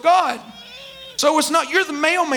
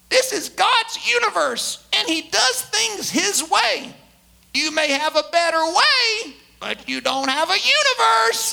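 put-on slow, much higher old preacher's voice; old J Vernon McGee; 6 semitones higher, slower than usual. Preacher imitating an aged radio preacher for his congregation, slow and high. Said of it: "he'd talk really slow and in a high-pitched voice".

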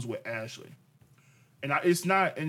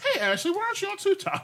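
much higher, laughing exaggerated yell; mock yelling quote. Husband parodies how wife heard his TikTok question, laughing. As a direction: overdo her version of his question with a laugh. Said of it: "this is exactly what I said".

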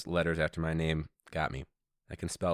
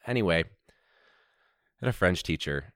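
usual speaking voice

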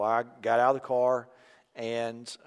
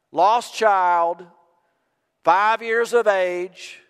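loud monotone town crier call; town crier shout; 6 semitones higher, 7 dB louder, much slower than usual. Retold or imitated. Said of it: "he would shout in a monotone voice".

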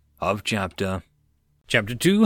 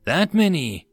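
higher, louder surprised question; 6 semitones higher, 5 dB louder than usual. A narrator's reading. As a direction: ask with mild astonishment. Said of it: "Tyria was surprised".

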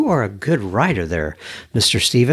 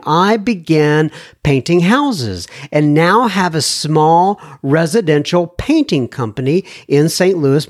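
lightly raised reading voice; email read aloud; 3 semitones higher than usual. Acted. Host reads listener email to audience, lightly raised voice. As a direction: read the letter like you're quoting him.

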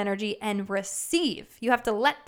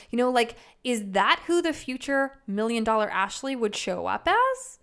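ordinary talk

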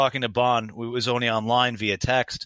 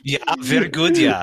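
put-on high, playful German accent; hammed-up German approval; mock German accent; 13 semitones higher, 5 dB louder than usual. Joins in the German accent game.

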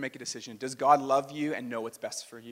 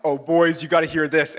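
a lively, louder tease before a juicy story; eager buildup; 3 semitones higher, 7 dB louder than usual. Retold or imitated. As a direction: call the guys over, itching to share gossip. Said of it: "I've been like".

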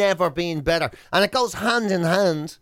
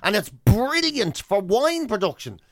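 ordinary delivery

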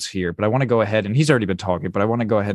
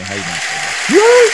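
mock grand host greeting; 3 semitones lower, 7 dB louder, slower than usual.

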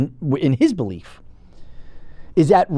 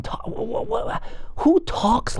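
stammering disbelief; 6 dB quieter, much slower than usual. Acted. Mocking Kerry's speech.

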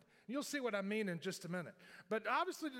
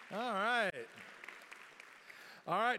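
usual speaking voice

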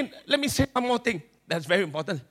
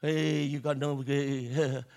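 nagging inner voice in Singlish; 9 semitones lower, 7 dB quieter, much slower than usual. Acted. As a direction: scold yourself in Singlish. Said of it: "My voice of my insecurity will come out".

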